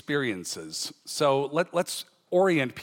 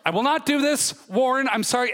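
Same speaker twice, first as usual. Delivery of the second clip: exasperated protest; 9 semitones higher than usual. Acted. John refusing to be poked.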